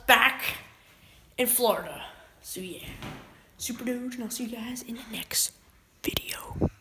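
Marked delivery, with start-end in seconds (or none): 2.4-6.7 whispered sign-off; breathy late-night whisper. Acted. Late-night sign-off repeated quietly.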